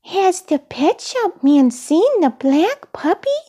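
high-pitched eager puppet question; high, hopeful character voice; Elmo, excited. A narrator's reading. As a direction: ask excitedly in a small high voice. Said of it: "Elmo asked excitedly".

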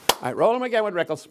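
high, drawn-up call across the set; studio crew call. Retold or imitated. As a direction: call it out like a TV floor director.

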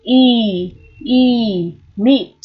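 "Y" held as a long ee, then "meat"; long ee sound. Shown as a demonstration. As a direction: draw out "Y" as eee, then say "meat" with it. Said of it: "kéo dài cái âm Y này ra một chút".

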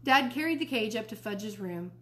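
ordinary delivery

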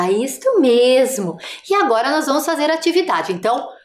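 ordinary talk, nothing marked

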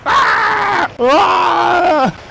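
high, drawn-out yell; long scream. Pretend. Gamer under attack in the hunt, high long scream.

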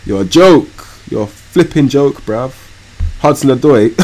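usual speaking voice

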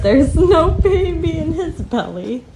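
put-on giggling through the child's words; can barely finish for laughing; laughing quote. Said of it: "And he said".

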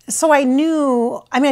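ordinary delivery

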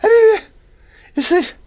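sudden high panicked yelps; startled squeak. Real, not acted. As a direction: jump in fright, voice shooting up. Startled high squeak, being chased.

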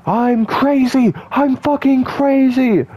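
very high, loud put-on crazy voice, slowed; high, loud, slow manic mock yell. Shown as a demonstration. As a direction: squeal it like a cartoon maniac again on purpose. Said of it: "I love talking like that".

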